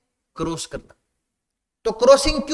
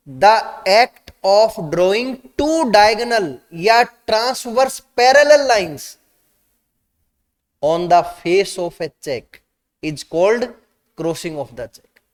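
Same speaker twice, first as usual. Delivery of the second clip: very slow, louder reading of a definition; slow dictation; 3 dB louder, much slower than usual. Shown as a demonstration. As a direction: read it out word by word so students can copy it down. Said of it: "crossing की definition को read out करवा देता हूं".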